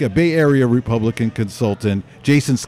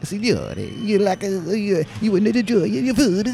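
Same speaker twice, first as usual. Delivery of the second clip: high, softer put-on Italian waiter; fake Italian accent; 8 semitones higher, 3 dB quieter than usual. Staff imitating the owner to a diner, fake Italian accent. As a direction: fuss over the guest in a fake Italian accent. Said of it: "they're, like, doing that voice".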